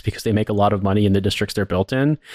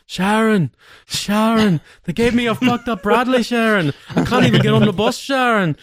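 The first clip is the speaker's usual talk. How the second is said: high, whining Brummie wail calling for his wife; 12 semitones higher than usual. Retold or imitated. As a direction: bleat her name like a muddled rock star. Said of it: "interpreting a slight different version of [name]".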